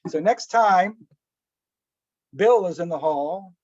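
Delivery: ordinary talk, nothing marked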